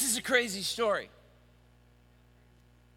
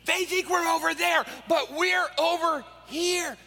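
gleeful fish; 8 semitones higher than usual. Acted. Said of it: "there are fish under there going".

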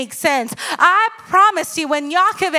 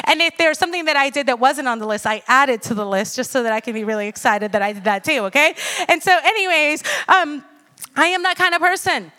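usual speaking voice

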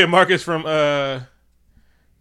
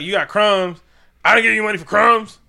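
higher and louder, indignant boss yelling at his runner; mock angry scolding; 8 semitones higher, 10 dB louder than usual. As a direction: scold him over the crumbs. Russian boss scolding the kid.

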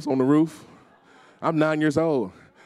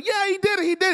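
cheering; faster than usual. Retold or imitated. Kids on the bed cheering their friend's win, shouting. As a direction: erupt like your team just won the Super Bowl.

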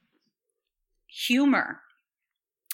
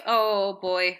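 usual speaking voice

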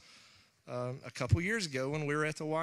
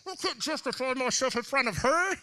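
mock sulky thought; 8 semitones higher, 4 dB louder than usual. Retold inner thought, voiced.

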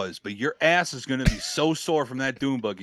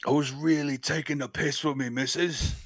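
softer, Kingsman-style British voice; British accent; 2 semitones higher, 5 dB quieter than usual. Shown as a demonstration. Friend tries out a British accent, sounding like a Kingsman. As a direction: play a posh London agent, a bit softer. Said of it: "You sound like a Kingsman".

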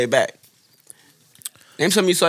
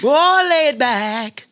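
sing-song; 8 semitones higher, much slower than usual. Pretend. Teasing sing-song repetition.

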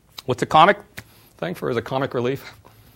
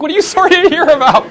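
high, loud incredulous laugh-through; laughing disbelief; 15 dB louder than usual. Retold or imitated. CEO answering a pitying acquaintance, laughing disbelief.

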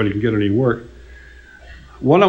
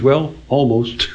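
slow deadpan understatement; dry understatement; slower than usual. Retold or imitated. Marion teasing the singer about the note, slow deadpan. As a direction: let it drop dry and slow. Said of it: "he has this wonderfully dry quality".

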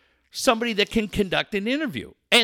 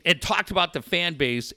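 normal talking voice

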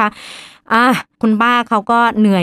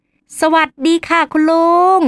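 high, drawn-out retold hello; polite greeting; 7 semitones higher, slower than usual. Doctor greeting the uncle on a home visit, politely drawn out. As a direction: greet an elder slowly in a raised, singsong pitch. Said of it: "กล่าวทักทายคุณลุง".

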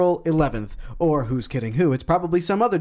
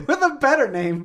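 repeats it through laughter, high; amused high echo; laughing; 9 semitones higher than usual. Real, not acted. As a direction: laugh while quoting him back.

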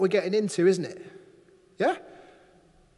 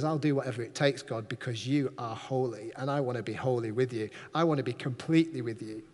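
devoted prayer; 7 semitones lower, 5 dB quieter than usual. Acted. Isaiah vowing himself to God, soft and low. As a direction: lower and softer, a sincere vow.

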